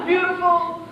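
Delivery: giggly self-pleased laugh. Acted. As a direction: laugh as you say it. Laughing at the compliment.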